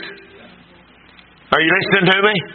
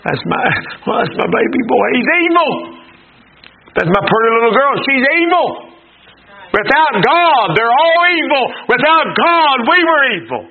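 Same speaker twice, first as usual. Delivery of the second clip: preaching fervor; 6 semitones higher than usual. Acted. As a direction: raise it like a revival shout. Preaching at full force, rising.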